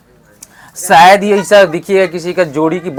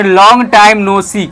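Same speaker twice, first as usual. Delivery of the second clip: slow, louder model phrase; slow model line; 4 semitones higher, 5 dB louder, much slower than usual. Shown as a demonstration. Teacher models the greeting slowly and loudly for students. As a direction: say it slowly and clearly. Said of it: "क्या बोलेंगे?".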